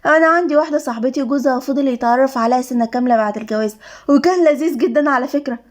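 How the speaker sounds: ordinary delivery, nothing marked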